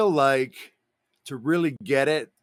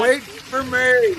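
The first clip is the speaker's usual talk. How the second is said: drawn-out call; 10 semitones higher, 6 dB louder, much slower than usual. Pretend. Calling after the leaving dog.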